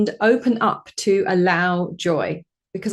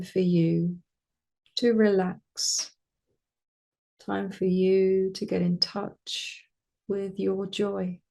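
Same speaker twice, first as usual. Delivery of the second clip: calm meditation voice with long pauses; guided meditation. Acted. Meditation teacher guiding listeners toward joy, calm spacious voice. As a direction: lead them gently inward, phrase by phrase.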